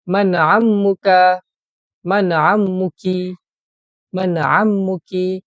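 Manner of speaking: teacher calling out a question; slow loud model question. Shown as a demonstration. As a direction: ask it out loud and slowly so the class can follow. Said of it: "kita boleh cuba".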